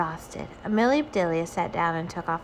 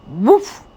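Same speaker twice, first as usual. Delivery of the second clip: loud single woof; bark; 5 semitones higher, 11 dB louder than usual. Acted. A hidden puppy barking at Amelia Bedelia, woof. Puppy barks behind tree.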